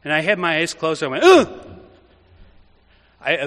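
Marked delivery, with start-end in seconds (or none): 1.2-1.8 sudden high, loud shocked gasp; startled gasp. Retold or imitated. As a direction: recoil out loud as if hit. Startled by his own face.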